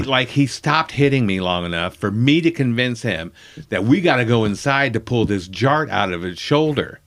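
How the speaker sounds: normal talking voice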